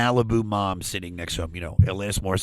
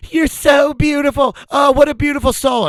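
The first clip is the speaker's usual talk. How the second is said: very high, louder cooing; gushing moms; 7 dB louder than usual. Retold or imitated. Moms whispering in Bill's ear, high gushing coo. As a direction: swoon over him like a Malibu mom. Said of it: "they're in his ear being like".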